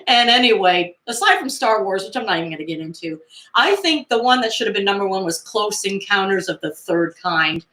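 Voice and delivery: ordinary talk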